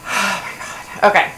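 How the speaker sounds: ordinary talk, nothing marked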